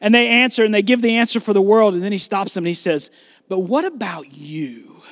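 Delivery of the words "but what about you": soft, slow, lowered voice; quiet, pointed question. Acted. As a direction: drop low and soft to put it to them directly. Jesus stops disciples, asks them directly.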